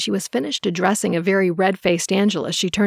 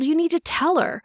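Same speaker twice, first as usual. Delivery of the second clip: high, angry narrated snarl; 9 semitones higher than usual. A narrator's reading. Angela snarls at Peter to tell her, high and angry. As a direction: demand it through bared anger. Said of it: "Angela snarled".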